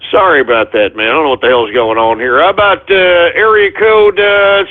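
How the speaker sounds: ordinary talk, nothing marked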